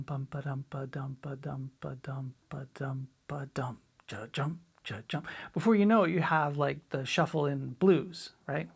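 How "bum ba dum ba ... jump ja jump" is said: soft, lower, unvoiced whispered rhythm syllables; slowed whisper. Shown as a demonstration. Drum teacher slowing the shuffle feel for students, whispered. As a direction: breathe the ba-dums slowly and softly. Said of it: "If you slow that way down".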